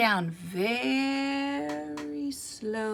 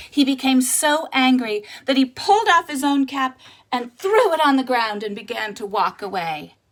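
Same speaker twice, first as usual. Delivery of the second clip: ordinary delivery, nothing marked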